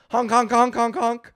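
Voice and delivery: loud honking horn noises; silly clown. Pretend.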